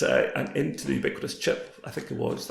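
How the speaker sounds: ordinary delivery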